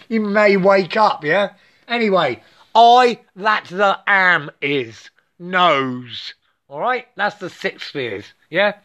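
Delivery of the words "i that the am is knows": each word slow and separate; deliberate spoken mantra; slow, measured recital. Shown as a demonstration. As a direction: say it slowly, word by word. Spoken take before the chanted one.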